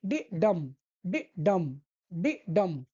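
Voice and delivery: "डि डम" with a light डि and a heavy, falling डम; iambic beat demo. Shown as a demonstration. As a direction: tap out डि-डम, stressing each डम. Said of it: "उसी हिसाब से इनका sound होता है". (डि हल्का, डम पर ज़ोर)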